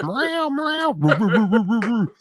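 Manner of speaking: high meowing, then barking roos; cat and dog noises. Retold or imitated. Retelling a Walmart coworker, high meows and barks. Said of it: "and he'd be like".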